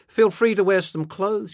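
loud, high sarcastic invitation; pointed chiding. A narrator's reading. Mother to her undressed son, pointed chiding.

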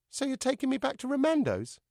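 high-pitched anxious question; worried dummy. Acted. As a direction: ask your owner in a high puppet voice. Dummy fears being returned.

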